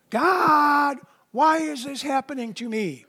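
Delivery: hammed-up wail of why me; complaining loudly to God, half-mocking; mock lament. Imitating a believer complaining to God.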